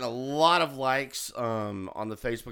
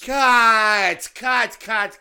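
shouting cut; 7 semitones higher, 10 dB louder, much slower than usual. Acted. Director stopping the take.

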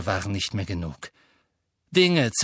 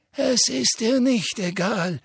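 put-on gentle old headmaster; 11 semitones higher, 3 dB louder than usual. A narrator's reading. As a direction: comfort the boy, unhurried. Dumbledore calm reply.

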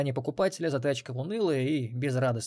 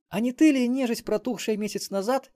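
put-on high, loud accusing young woman; accusing girl; 11 semitones higher, 4 dB louder than usual. A narrator's reading. Melissa accuses Xen of being undead, high and loud. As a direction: jab at him with the question, high.

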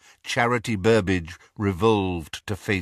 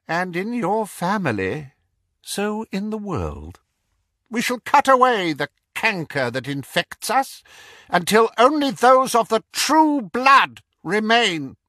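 high, cold, mocking dark lord; Voldemort voice; 12 semitones higher, 4 dB louder than usual. A narrator's reading. Voldemort speech.

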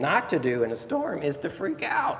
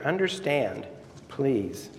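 normal talking voice

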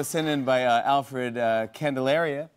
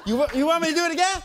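excited; 14 semitones higher, 4 dB louder, much faster than usual. Real, not acted. Fast high teasing question.

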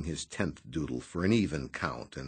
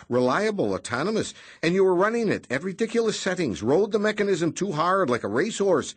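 higher, louder indignant protest; protesting Noonan; 10 semitones higher, 7 dB louder than usual. A narrator's reading. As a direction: object with mock outrage. Noonan tirade.

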